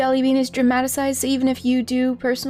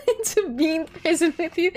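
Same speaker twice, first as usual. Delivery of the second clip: high, giggling protest through real laughter; laughing disbelief; 7 semitones higher than usual. Real, not acted. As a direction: laugh while protesting at being made a bean. Laughs as she's turned into bean.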